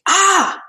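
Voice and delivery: high mock scream; read-aloud scream. Reading the poem aloud to class, a high mock scream. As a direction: cry out in sudden fright. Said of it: "I screamed".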